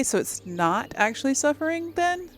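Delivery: high, climbing, puzzled questions; really baffled, voice rising high; confused questioning. Real, not acted. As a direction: pitch climbing into baffled disbelief. Puzzling over dialogue option's meaning.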